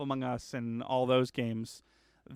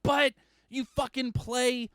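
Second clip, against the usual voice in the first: excited; 7 semitones higher than usual. Real, not acted. Excited about the game.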